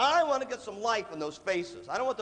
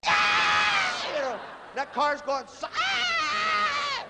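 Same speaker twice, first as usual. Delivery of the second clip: much higher, louder panicked yell; panicked scream; 4 dB louder, much slower than usual. Retold or imitated. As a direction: scream as the traffic stops. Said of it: "you're like".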